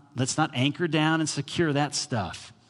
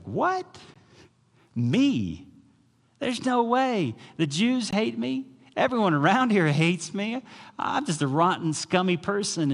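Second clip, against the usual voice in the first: much higher, disbelieving outcry; stunned centurion; 9 semitones higher than usual. Retold or imitated. The centurion answering Jesus in high-pitched disbelief. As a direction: squeak out amazed self-doubt. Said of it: "He's like".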